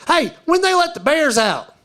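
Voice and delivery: high-pitched clueless tourist call; imitated tourist. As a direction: pipe up like an eager tourist. Mimics tourists calling out question.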